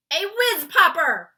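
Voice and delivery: loud, high delighted giant cry; beaming cry. A narrator's reading. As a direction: shout it with joy. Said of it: "cried the B F G, beaming".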